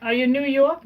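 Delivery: ordinary delivery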